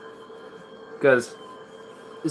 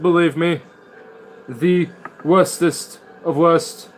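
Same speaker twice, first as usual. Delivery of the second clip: higher, louder hammed-up political pronouncement; mock Trump-style speech; 6 semitones higher, 4 dB louder than usual. Streamer mocking Trump after a rant about him, hammed-up declaiming. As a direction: declare it emphatically, like a parody of a speechifying president.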